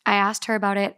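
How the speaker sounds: normal talking voice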